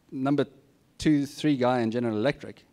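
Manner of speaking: usual speaking voice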